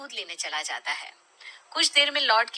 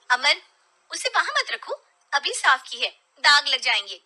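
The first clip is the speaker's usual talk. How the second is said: a hint of a fussy wife, a bit higher; wife scolding; 5 semitones higher, 7 dB louder than usual. Wife scolding husband over the cup, sharp and brisk. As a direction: tick him off about the clean table.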